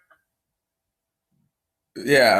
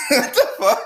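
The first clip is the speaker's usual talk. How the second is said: laughing disbelief. Retold or imitated. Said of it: "And I'm just like".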